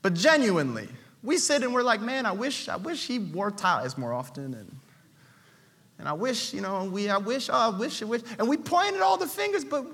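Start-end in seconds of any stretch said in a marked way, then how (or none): 2.0-8.2 soft, grumbling wishes under the breath; much lower and softer grumbling; muttered complaint. Retold or imitated. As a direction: whine quietly from the pew. Pew complainers voiced.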